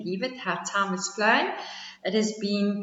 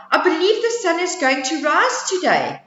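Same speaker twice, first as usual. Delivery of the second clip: high owl voice; 8 semitones higher, 4 dB louder than usual. Acted. Owl agreeing with turtle, high voice. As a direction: agree brightly.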